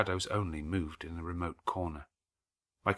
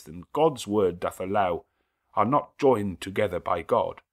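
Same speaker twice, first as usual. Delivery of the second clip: solemn clergyman; 3 semitones higher, 7 dB louder than usual. Acted. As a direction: proclaim it like a priest at the altar. Clergyman intoning wedding charge.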